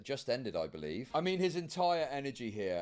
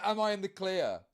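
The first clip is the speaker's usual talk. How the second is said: imitating worried streamer; 6 semitones higher, 4 dB louder than usual. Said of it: "It's like".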